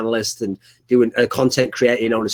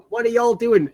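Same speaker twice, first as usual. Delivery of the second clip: a mock Texas housewife voice, raised high; Texan wife; 8 semitones higher than usual. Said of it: "She's from Texas".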